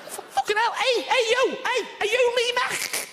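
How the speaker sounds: very high, squeaky Scouse with clicking throat sounds; exaggerated Scouse turning into dolphin chatter; high Scouse stuttering into dolphin clicks. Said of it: "so Scouse they sound like they're turning into a dolphin".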